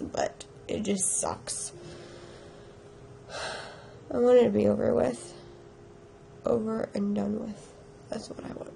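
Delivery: weary, sick voice. Real, not acted. Sick and tired.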